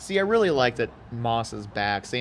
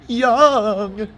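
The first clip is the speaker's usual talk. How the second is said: higher, louder and slow, stretched out; excited play-by-play cry; excited drawn-out call; 10 semitones higher, 9 dB louder than usual. Real, not acted. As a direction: sing out the runner's name. Calling his name again.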